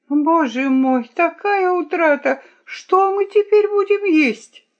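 high-pitched lamenting whine; whining. A narrator's reading. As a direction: wail fretfully, pitched very high. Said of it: "Чего ты ноешь?". (высокое жалобное нытьё)

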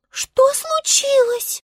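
very high, slow alarmed voice; frightened star. A narrator's reading. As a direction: ask in high-pitched fright. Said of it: "перепугалась звезда". (испуганный высокий голос звезды)

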